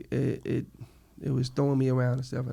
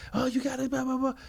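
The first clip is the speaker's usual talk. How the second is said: high-pitched mock warden rant; flipping-out warden; 11 semitones higher than usual. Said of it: "she comes out flipping out".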